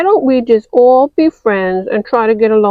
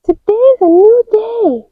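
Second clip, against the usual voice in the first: put-on high, bright-eyed toy girl voice; a high chirpy doll voice; high character voice; 10 semitones higher than usual. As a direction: play the cheerful little girl figure. Child playing with toy figures.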